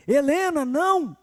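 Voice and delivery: father shouting a warning at his running daughter, loud; retold shout. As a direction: yell to stop a running child. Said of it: "E eu gritei".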